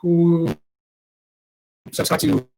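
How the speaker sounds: audio dropping out; 소리가 끊어지는 마이크; fading mic. Real, not acted. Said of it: "Oh, your mic again".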